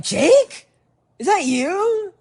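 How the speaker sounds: very high, loud questioning shout; calling out in surprise. Retold or imitated. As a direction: holler to a friend across the street. Calls to friend across street.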